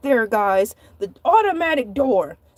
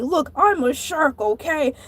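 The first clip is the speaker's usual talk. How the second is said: usual speaking voice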